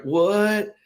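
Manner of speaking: high, drawn-up "what"; mock astonishment. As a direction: act out a kid's disbelief. Retells his childhood amazed reaction.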